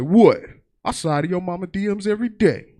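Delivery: imitating dad. Father teasing from background, loud boast. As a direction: brag it like a cocky dad.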